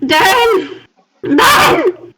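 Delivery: high, rising-urgency name call; insistent calling out. Acted. Character summoning a companion from the tent, insistent and high. Calls again after companion answers.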